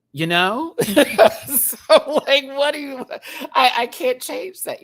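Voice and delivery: high, loud, giggling through the words; giggly self-mocking confession; laughing. Real, not acted. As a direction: laugh at yourself while owning it. Laughing at own rambling.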